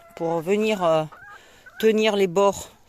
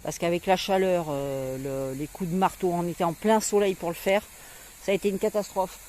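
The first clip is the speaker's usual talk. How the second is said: ordinary talk, nothing marked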